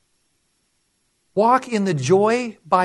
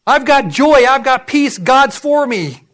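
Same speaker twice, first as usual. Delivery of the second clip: raised, punchy declaration of faith; emphatic declaration; 4 semitones higher, 6 dB louder, slower than usual. Acted. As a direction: proclaim it boldly, higher and louder. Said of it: "Turn that around".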